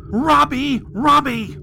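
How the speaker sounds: high-pitched woman's call. A narrator's reading. Angry mother summoning her son from the restroom, calling out. Mother calls boy from hall.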